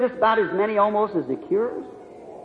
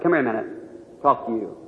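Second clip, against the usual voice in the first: low, hushed call to a son; quiet fatherly summons; 10 semitones lower, 7 dB quieter, faster than usual. Retold or imitated. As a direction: softly take the boy aside. Father takes son aside, retold.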